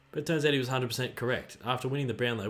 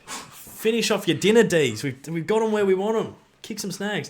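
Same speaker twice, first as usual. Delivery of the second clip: cheering; 6 semitones higher, 7 dB louder than usual. Real, not acted. Fan cheering the Demons to finish the game off, loud and rallying. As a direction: urge the team on.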